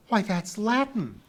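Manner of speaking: high, loud gasp of amazement; gasped astonishment. A narrator's reading. Pee-wee amazed to hear Latin, gasping. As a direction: exclaim in astonishment, pitch way up. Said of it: "Pee-wee gasped".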